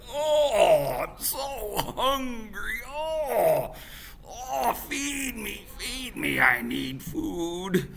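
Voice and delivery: high, slow whiny pleading; hungry baby. Acted. Hungry baby Nanabuju crying to be fed. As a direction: whimper for food. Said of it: "he was like".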